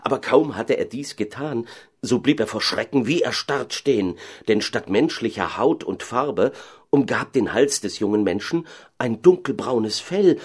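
plain reading voice

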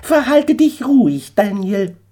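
a proud eagle laying down terms; haughty eagle. Acted. Eagle urges Daniel off the sinking stone, high and haughty.

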